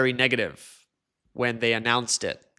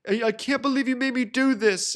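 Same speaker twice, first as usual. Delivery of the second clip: high-pitched clickbait indignation; hammed-up whiny protest; mock outrage; 11 semitones higher than usual. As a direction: imitate an aggrieved YouTuber, voice pitched up. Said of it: "part of the whole clickbait thing".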